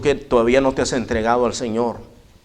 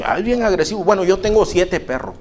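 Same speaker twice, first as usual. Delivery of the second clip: higher, insistent complaint; fed-up man voiced higher; 7 semitones higher than usual. Visitor insists the dog is aggressive, pitched up. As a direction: play him pitched up and insistent.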